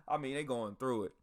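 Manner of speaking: ordinary delivery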